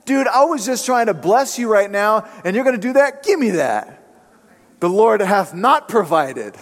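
mock indignant outburst. Preacher acting his old self snapping at an ungrateful man, mock angry. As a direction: play the huffy old self who wants his pizza back. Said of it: "get in that guy's face and say".

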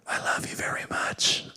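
very soft parent's whisper; tender whisper. Retold or imitated. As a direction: whisper it lovingly to your children. Retells whispered secret to his kids.

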